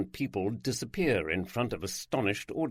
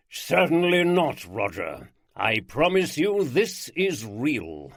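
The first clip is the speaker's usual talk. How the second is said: slow, louder theatrical lord proclaiming; grand showman lord; 3 semitones higher, 5 dB louder, slower than usual. Acted. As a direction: unveil himself like a stage magician.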